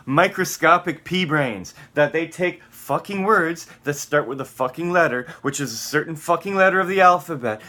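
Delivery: real exasperated shouting; angry rant. Real, not acted. Furious rant at "pea brains.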